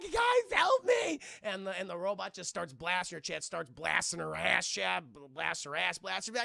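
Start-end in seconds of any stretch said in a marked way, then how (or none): 0.0-1.2 high, very slow, loud cry for help; mock desperate scream; shrieking for help. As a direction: yell desperately to friends. Calling for help while chased.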